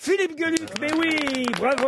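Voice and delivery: high, bright cheer of the right answer; excited confirmation. Real, not acted. (félicitations enthousiastes, voix haute)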